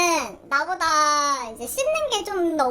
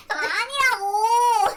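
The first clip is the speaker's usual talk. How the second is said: drawn out very slow, higher and louder; whiny protest; 6 semitones higher, 4 dB louder, much slower than usual. Real, not acted. Teased about farting, drawn-out whiny protest. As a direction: whine the denial, stretching it.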